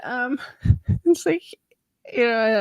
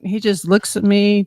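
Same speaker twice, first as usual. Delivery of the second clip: ordinary delivery, nothing marked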